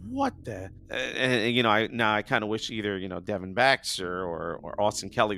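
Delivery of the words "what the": high mock-startled reaction; retold disbelief. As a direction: jump up in pitch, imitating surprise. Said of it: "I was like".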